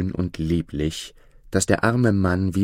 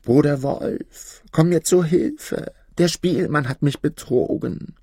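wailing cry; 8 semitones higher than usual. A narrator's reading. Fox calling the wolf for help, high acted wail. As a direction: plead in a high, whining fox voice. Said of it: "fing er an zu jammern und schrie aus Leibeskräften".

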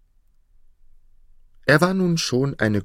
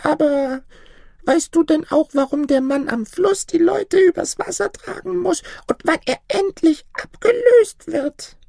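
put-on very high, piping old woman voice; high-pitched, insistent little granny; inquisitive old granny; 5 dB louder than usual. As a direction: press the question in a tiny crone voice. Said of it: "staunte das Mütterchen".

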